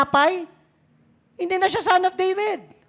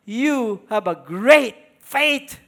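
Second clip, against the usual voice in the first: gentle Jesus voice; 4 semitones lower, much slower than usual. Acted. Jesus answers the Canaanite woman.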